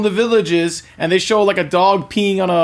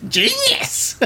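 high squealing witch cry; 3 dB quieter than usual. Pretend. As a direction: shriek it like a delighted witch. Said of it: "can we hear your witch voice again?".

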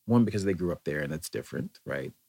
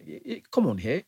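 put-on goofy high-pitched British accent, much higher; bad British accent; 6 semitones higher than usual. Mock British accent to English friends, much higher. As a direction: ham up a silly posh English accent. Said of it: "What kind of accent are you doing?".

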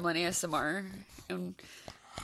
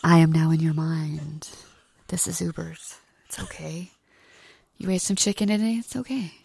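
lower, slow hypnotist voiceover; mock hypnotic voiceover; 4 semitones lower, 5 dB louder, slower than usual. Voiceover soothing the pug to sleep. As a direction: lull the dog like a spooky mind-control voice. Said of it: "you'd have, like, a voiceover".

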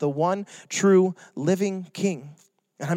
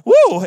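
high, loud celebratory whoop; excited whoop; 12 semitones higher, 8 dB louder than usual. Acted. Pastor cheering at the bathroom sign, loud whoop. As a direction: let out a big happy woo.

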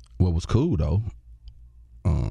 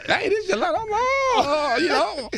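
starstruck squeal. Retold or imitated. Retelling the starstruck phone reaction.

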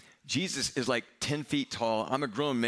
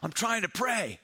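yelled; 12 semitones higher, slower than usual. Retold or imitated. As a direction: bellow it across four hundred yards. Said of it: "this person yelled".

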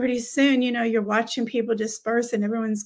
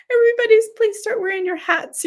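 pleading; 12 semitones higher, 6 dB louder, faster than usual. Retold or imitated. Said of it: "bad thing about going back to America".

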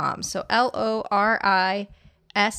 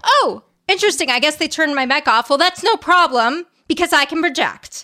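imitated indignant projecting, loud, high and fast; mock-furious defiance, shouted at the room; pissed off; 7 semitones higher, 6 dB louder than usual. Imitating a speaker whose mic went dead, pissed off. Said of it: "So she gets fucking pissed".

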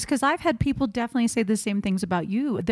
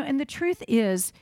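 normal talking voice

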